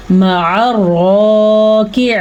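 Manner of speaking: slow tajweed chant with drawn-out long vowels; Quran recitation. Shown as a demonstration. Teacher modelling the verse for students, slow tajweed recitation. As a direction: recite word by word, holding each madd.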